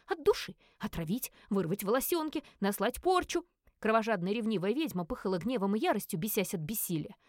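usual speaking voice

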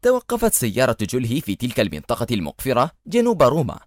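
ordinary delivery, nothing marked

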